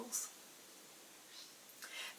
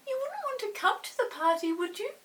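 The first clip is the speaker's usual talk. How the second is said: high, quick little earl voice; 12 semitones higher, 4 dB louder than usual. Acted. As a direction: pipe up like a tiny earl asking a favour. Earls shyly invite Chinky.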